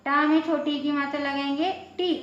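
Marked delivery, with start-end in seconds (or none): 2.0-2.1 टी with a short, clipped i. Shown as a demonstration. Teacher showing the short i matra on ta, short vowel. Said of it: "छोटी आवाज़ निकलती है".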